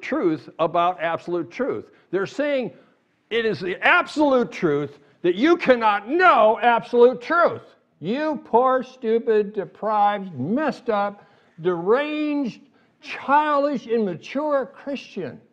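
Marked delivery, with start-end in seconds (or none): 3.3-7.6 higher and louder, pressing every word of the claim; loud mocking skeptic claim. Shown as a demonstration. Preacher voicing the skeptics' claim to the congregation, loud and mocking. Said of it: "What are they saying?". 8.0-15.4 mock contempt. Voicing skeptics insulting believers.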